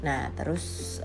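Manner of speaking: ordinary talk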